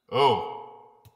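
ordinary talk, nothing marked

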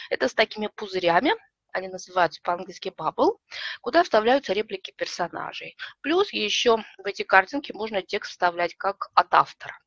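ordinary talk

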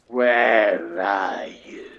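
low, very slow 'where are you'; drawn-out seek-and-find call. Pretend. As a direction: stretch each word out, calling low into the distance. Calling out to hiding zombies.

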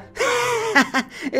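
high-pitched burst of laughter; high giggle. Acted. Son laughs at the villain's silly name, high giggle.

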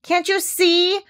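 indignant high shout. A narrator's reading. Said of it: "shouted Piglet".

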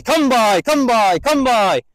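high, loud calls to the dog; sing-song herding call; called dog command. Shown as a demonstration. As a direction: call it out across the field. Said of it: "I would still be saying it if it was puppy".

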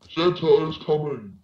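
high, voice-changer Santa; high altered voice. Pretend. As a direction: sing it out high.

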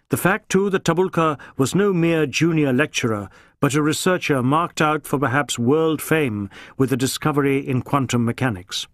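usual speaking voice

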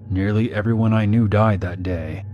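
ordinary delivery, nothing marked

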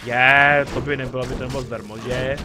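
very loud "Je" of indignation; outraged outburst. Real, not acted. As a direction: blurt it out at full volume. Outraged the item is not free.

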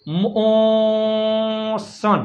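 drawn-out syllable by syllable; slow sounded-out word. Shown as a demonstration. Teacher blending letters into a word slowly. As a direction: let the word stretch so kids hear each sound. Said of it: "મ ઓ".

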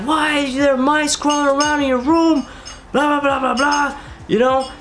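high-pitched mock mom freaking out; mimicking a frantic mother; imitating mom. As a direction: squeak it out like a panicked mom. Mom freaking out about mice.

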